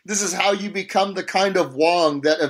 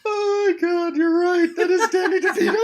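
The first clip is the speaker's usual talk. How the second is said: high laughing exclamation. Real, not acted. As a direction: burst out laughing in recognition. Laughing realizing the DeVito voice.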